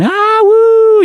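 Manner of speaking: imitating a loud, very high whooping squeal; mock party scream. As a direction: shriek and whoop like a rowdy bachelorette. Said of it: "jumps up and starts screaming".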